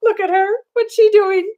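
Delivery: ordinary delivery, nothing marked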